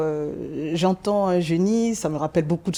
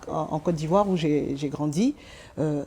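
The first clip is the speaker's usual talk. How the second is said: ordinary talk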